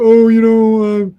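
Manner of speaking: loud, high, mock-indignant complaint; whiny soldiers. Voicing the soldiers kept out of the action, mock offended. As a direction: whine about being left out.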